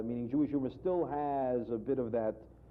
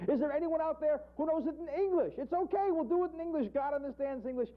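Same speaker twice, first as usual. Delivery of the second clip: high cantorial wail to the crowd; pleading rabbi. Acted. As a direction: chant the question like a desperate cantor. President pleads in English.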